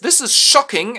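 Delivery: high, loud scandalised cry; hammed-up high shocked protest; mock outrage. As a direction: gasp it out, scandalised. The girls protest.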